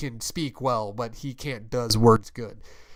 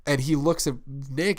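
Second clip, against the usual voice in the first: normal talking voice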